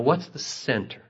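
ordinary talk

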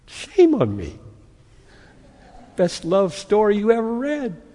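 normal talking voice

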